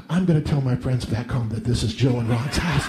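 low, hushed gossipy aside; a hint of a gossiping mother telling the other mother-in-law; sly gossip. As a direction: murmur like a scheming mother. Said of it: "overhears my mother say".

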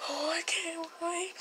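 excited breathy whisper; eager whisper. Acted. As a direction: whisper it, barely containing yourself. Sneaking to see the food.

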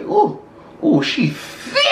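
high delighted ooh. Real, not acted. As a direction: swoon over the taste. Tasting sauce.

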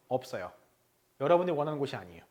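ordinary talk

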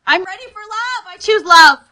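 high angry shout. Shown as a demonstration. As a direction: shout the affirmation with mock fury. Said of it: "say it like you're angry".

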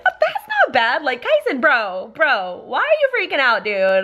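mock little-boy incredulity, starting high; imitating dismissive kid. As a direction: tease a brother for overreacting. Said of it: "with that tone of voice".

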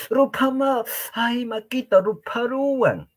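high, pained "ay, Maquita" cry; mock cry of pain. Teacher acting out a burn, high pained cry. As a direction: yelp it like a burnt hand.

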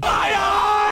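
high excited yell; shouting. Real, not acted. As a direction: shout it out, pitch way up.

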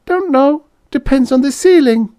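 high squeaky granny, matter-of-fact; deadpan tiny old lady squeak. A narrator's reading. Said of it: "Missus Quimby said".

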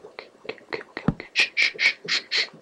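very soft, voiceless whisper; whispered hushing. Real, not acted. Gamer calming down after a scare, whispering. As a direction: hush yourself down in a tiny whisper.

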